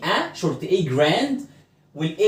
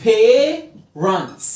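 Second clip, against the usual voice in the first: PARENTS split into "PAY... runts"; slow, drawn-out model of parents; slow syllable demonstration; 4 semitones higher, 4 dB louder than usual. Shown as a demonstration. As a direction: stretch the first syllable of parents, then finish it. Slow syllable demo in lesson.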